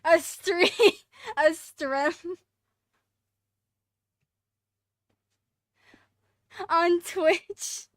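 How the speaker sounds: loud excited chatter; laughing at a chat message. Real, not acted.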